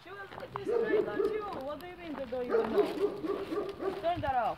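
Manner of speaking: loud, sharp telling-off; scolding. Real, not acted. Mother scolding the child.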